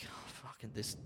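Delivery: very soft, near-whispered aside; muttered to self. Retold or imitated. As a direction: whisper it as a private thought. Private thought while staff confer.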